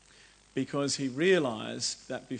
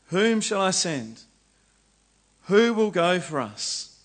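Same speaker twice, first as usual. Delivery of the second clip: higher, louder, slow call; 5 semitones higher, 8 dB louder than usual. Acted. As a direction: call out to be heard. Voice of the Lord calling.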